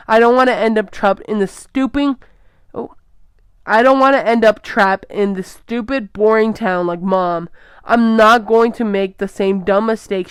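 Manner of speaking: loud bitter rant; angry outburst. Acted. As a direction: lash out to hurt him. Daughter lashing out at father.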